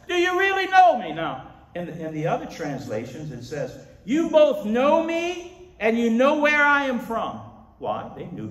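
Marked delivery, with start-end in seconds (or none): 0.1-1.1 crying out to a temple crowd; high, booming challenge with no microphone; loud voice. Acted. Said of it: "He said in a loud voice". 4.0-7.4 slow, high proclamation at full force; loud voice. Acted. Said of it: "He said in a loud voice".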